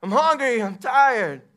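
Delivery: loud, high exasperated shouting; fed-up yell at the top of the voice; yelling tired parent. Acted. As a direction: act out a worn-out parent blowing up at the kids. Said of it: "Are you yelling at everybody?".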